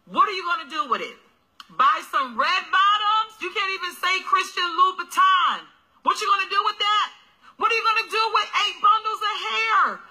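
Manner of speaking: rising fiery preacher rant; preaching. Acted. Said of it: "I'm a different kind of preacher".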